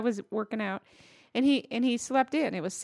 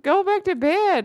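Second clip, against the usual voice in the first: insistent; 8 semitones higher, 4 dB louder, slower than usual. Retold or imitated. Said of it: "I'm like".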